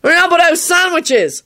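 high-pitched call from above; high hopeful holler; calling down loudly. A narrator's reading. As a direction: call down in a high, carrying boy's voice. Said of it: "Harry called down loudly".

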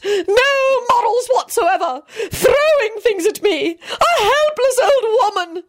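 high, slow wailing tirade; self-pitying old lady's high bellow. A narrator's reading. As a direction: rail hysterically, stretching each complaint. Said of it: "Lady Fanshawe bellowed".

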